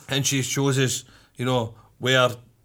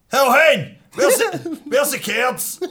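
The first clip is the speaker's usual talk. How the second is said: loud, high-pitched Glasgow bellow; exaggerated shouty impression of a man; mock broad holler; 11 semitones higher, 4 dB louder, slower than usual. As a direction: holler it across the shop, high and hammed-up. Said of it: "he's walking in like".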